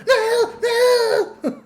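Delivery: very high, loud wailing no; mock crying shout. Streamer mocking Bridget's refusal, mock wailing shout. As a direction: wail it like a tantrum. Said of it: "They're like".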